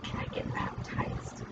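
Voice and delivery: retold request, whispered almost without voice; hushed question. Retelling how the man quietly asked to be baptized, whispered. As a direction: breathe the question softly, barely voiced.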